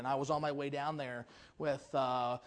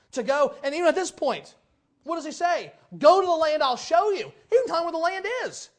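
loud, high sermon delivery; full-force preacher voice; fervent preaching; 8 semitones higher, 10 dB louder than usual. Acted. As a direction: press the congregation with questions.

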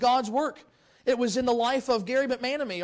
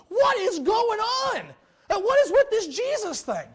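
higher, louder, slow stunned cry; bewildered outcry; 10 semitones higher, 7 dB louder, slower than usual. Retold or imitated. Gary stunned on a Dallas street corner, bewildered outcry. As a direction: cry out in disbelief, dragging the words.

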